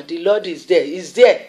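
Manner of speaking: ordinary talk, nothing marked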